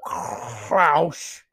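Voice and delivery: guttural, exaggerated German name; loud, slow, hammed-up German pronunciation; mock German accent. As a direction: drag out Klaus with a throaty German rasp. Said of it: "I can't do a German accent".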